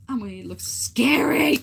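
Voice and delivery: loud, high, slow squeal; loud delighted squeal, real; excited shriek. Real, not acted. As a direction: gush over the scary puppet. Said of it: "reacting to puppet".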